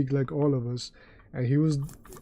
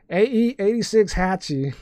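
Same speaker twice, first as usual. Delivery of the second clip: high-pitched, botched Southern accent; overdone first stab at a Southern accent; 6 semitones higher, 4 dB louder than usual. Shown as a demonstration. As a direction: try a Southern twang, pitched up. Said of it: "read that in a Southern accent".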